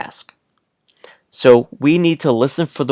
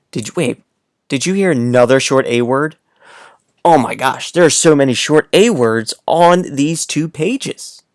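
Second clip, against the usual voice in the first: ordinary talk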